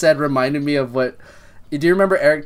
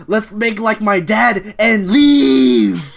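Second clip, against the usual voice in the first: slow, drawn-out joke line; cheeky quip voice; 6 semitones higher, 5 dB louder, much slower than usual. Retold or imitated. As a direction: deliver it like a punchline, slowly. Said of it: "he's just like".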